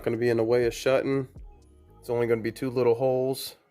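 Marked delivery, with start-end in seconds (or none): none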